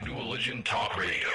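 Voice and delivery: booming station ID; radio announcer. Acted. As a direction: shout the station name like a DJ.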